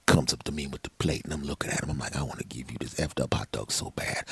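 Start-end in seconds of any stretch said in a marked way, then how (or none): 2.2-4.2 low, soft, seething; seething inner thought. Retold or imitated. Eyeing the neighbor, quietly seething to himself. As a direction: hold back the grudge quietly.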